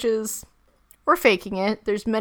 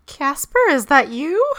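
calling out to a ghost; 6 semitones higher, 5 dB louder than usual. Pretend. Joking call to ghost.

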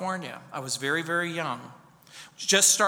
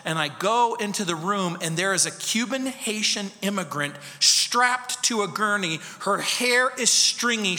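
ordinary talk

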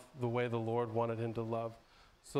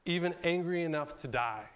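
higher, a mock angry retort; 4 semitones higher, 3 dB louder than usual. Jonah retorts to God, mildly hammed-up anger. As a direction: snap back with a touch of heat. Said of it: "And Jonah retorts back".

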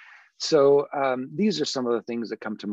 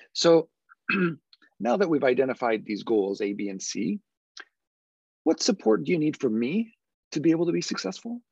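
higher, lilting question ending on a rise; approachable voice; 5 semitones higher than usual. Shown as a demonstration. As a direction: bob the pitch up and down, inviting. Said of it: "ask those questions now in an open, approachable type voice".

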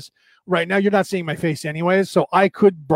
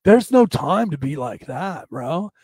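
slow imitation surfer dude; put-on California surfer drawl; laid-back surfer. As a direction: stretch it out like an easygoing surfer. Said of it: "People actually speak like that".

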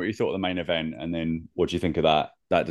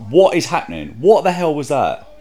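higher, louder outraged outburst; genuinely angry; 9 semitones higher, 7 dB louder than usual. Real, not acted. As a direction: blow up at the result in disbelief. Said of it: "I'm actually genuinely angry at that".

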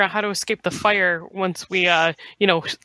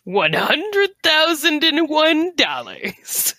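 high, drawn-out mock bid; playful bid, pitched way up and very slow; mock bidder; 7 semitones higher, much slower than usual. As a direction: stretch the figure out slowly in a high, put-on voice. Mock money bid at the game table.

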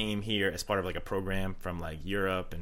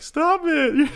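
whiny protest; 10 dB louder than usual. Retold or imitated. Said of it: "And I'm like".

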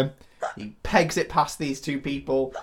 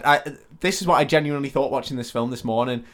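ordinary talk

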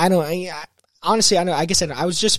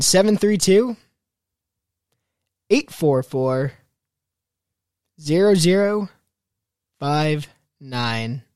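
slow, deliberate digits with long pauses; real slow; slower than usual. Real, not acted. Host repeating the number for callers to write down, real slow. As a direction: space it out for someone writing it down. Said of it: "I tried to say that real slow".

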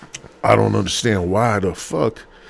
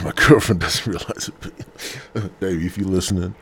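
usual speaking voice

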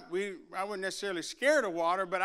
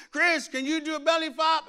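higher, louder playful call; friends teasing; 7 semitones higher, 6 dB louder than usual. Retold or imitated. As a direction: dare him from the water. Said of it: "you know".

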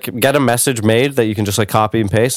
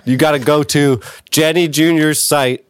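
ad read; 4 semitones higher than usual. Acted. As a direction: spell out the promo code. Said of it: "pretend to do an ad read".

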